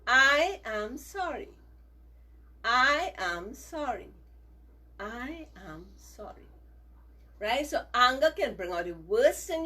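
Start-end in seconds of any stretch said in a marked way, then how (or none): 0.1-4.1 slow, firm and a bit louder; slow apology drill. Shown as a demonstration. Teacher leads the children in saying sorry, slow and firm. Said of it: "Say it three times". 5.0-6.5 "I am sorry" said slowly and much softer; soft apology. Shown as a demonstration. Teacher ends the children's sorry drill, soft and slow. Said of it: "Say it three times".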